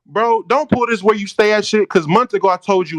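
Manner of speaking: loud, forceful outburst of irritation; angry confrontation. Real, not acted. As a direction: cut in hard and loud, calling out the bluff. Angry challenge to a fight.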